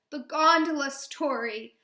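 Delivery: loud, slow, upset lament; distressed, drawn-out cry; sobbed words. Acted. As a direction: wail it as if close to sobbing. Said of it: "I sob".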